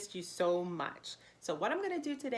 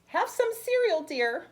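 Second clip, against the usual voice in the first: sweet mother; 7 semitones higher, 8 dB louder, slower than usual. Acted. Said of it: "my mother said".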